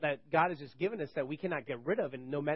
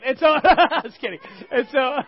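just kidding, laughing it off higher and louder; 7 semitones higher, 10 dB louder than usual. Real, not acted. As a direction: chuckle through the retraction. Laughs at own joke.

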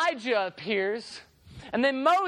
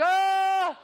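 long, high, loud "oh" of protest; reluctant groan; 8 semitones higher, 4 dB louder than usual. Acted. Disciples balk at the climb.

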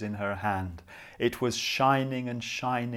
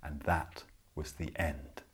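whispered ending; 8 semitones lower, 6 dB quieter than usual. A narrator's reading. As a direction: whisper the last words. Closing the bedtime story.

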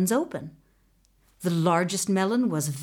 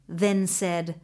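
ordinary delivery

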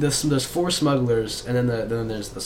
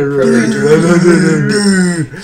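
derp face voice; 2 semitones higher, 11 dB louder, slower than usual. Acted. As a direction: stretch it into a silly derp noise. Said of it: "one is the derp face".

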